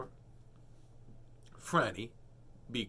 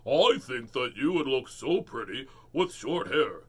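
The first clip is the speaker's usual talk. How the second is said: a dad's voice, slow and louder; father's voice; 5 dB louder than usual. Acted. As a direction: read it as a gentle father. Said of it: "why did he do his mother's voice".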